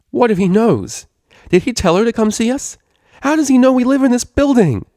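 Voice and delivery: high, loud, rising alarm; panicked rush. Acted. Vinyl, realizing the counselor knows, panicking at her roommate. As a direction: spiral into a frantic outburst.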